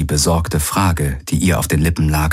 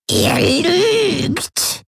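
cracked crying screech, pitched way up; croaking crazed old woman. A narrator's reading. A crazed old Voi croaks at the strangers, high and sobbing. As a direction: croak it like a mad grandmother near tears. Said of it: "krächzte Voi".

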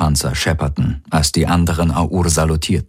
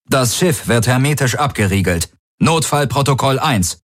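raised-pitch official order; commanding bark; 6 semitones higher than usual. A narrator's reading. Guard leader sealing the ship by comm, commanding. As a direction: command firmly, hiding the sorrow. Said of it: "aus ihrem befehlenden Bellen den Kummer".

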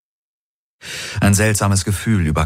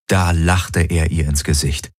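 plain reading voice

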